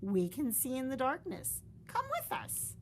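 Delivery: high reassuring voice; high encouraging mother; mother bat. Acted. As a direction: coax her into the sky. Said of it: "said Mother Bat".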